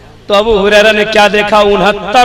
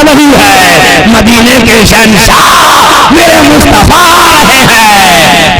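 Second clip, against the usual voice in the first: slow, swelling proclamation; exultant heralding; slower than usual. Real, not acted. Preacher announcing the Prophet's arrival, exultant shout. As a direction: announce a king's arrival to a crowd.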